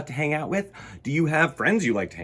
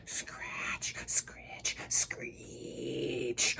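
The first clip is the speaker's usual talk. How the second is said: soft unvoiced scratching sounds; spooky whispered scratching; 12 dB quieter, much slower than usual. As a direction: breathe the creepy noises. Spooky window noise.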